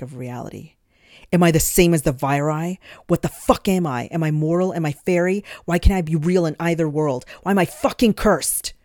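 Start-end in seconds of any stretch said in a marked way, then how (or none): 1.3-8.7 furious. A narrator's reading. Shalot rages at the green face.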